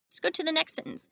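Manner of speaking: ordinary delivery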